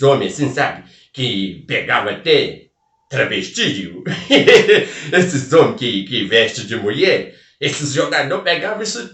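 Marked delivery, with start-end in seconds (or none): 4.0-5.1 quick high laugh at own joke; a burst of giggling; short laugh. Real, not acted. Laughing at his football joke for the audience.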